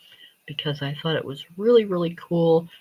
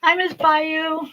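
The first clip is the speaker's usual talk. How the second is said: cutesy high greeting; 12 semitones higher, 4 dB louder, slower than usual. Acted. Greeting a coloring book character.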